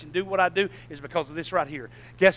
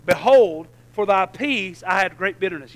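scripture reading; 6 dB louder than usual. Acted. Reads scripture aloud.

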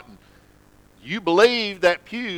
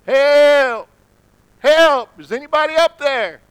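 high, loud cries for help from the ledge; yelling for help; 9 semitones higher, 7 dB louder than usual. Retold or imitated. Man hanging from a limb yells for help, loud. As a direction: holler up the cliff, desperate. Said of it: "he starts yelling out".

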